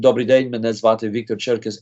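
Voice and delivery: greeting said in Ukrainian, "Добрый день" at a normal pace. Shown as a demonstration. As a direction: say "меня звати Victor Cherkess" as a learner of Ukrainian. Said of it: "I was trying to say in Ukrainian".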